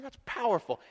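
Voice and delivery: ordinary talk, nothing marked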